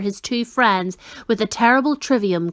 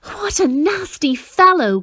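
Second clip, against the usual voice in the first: gasped; 8 semitones higher, 3 dB louder than usual. A narrator's reading. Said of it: "gasped Milo".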